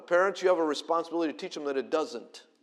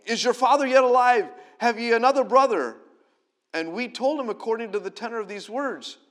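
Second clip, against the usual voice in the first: higher, louder pleading voices; quarrelling family voices; 8 semitones higher, 8 dB louder than usual. Acted. Brothers defending themselves to their father, high and loud.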